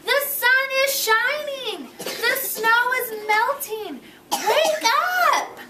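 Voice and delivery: high, loud, eager calling; excited calling. Acted. Frog calling Toad to come see spring, excited. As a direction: coax him out of bed. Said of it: "cried Frog".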